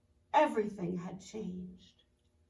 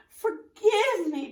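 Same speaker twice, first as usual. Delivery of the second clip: much higher, louder, slow plea; begging; 10 semitones higher, 7 dB louder, slower than usual. Acted. Tadpole begs caterpillar, high slow plea. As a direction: plead for forgiveness, drawn out. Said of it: "begged the tadpole".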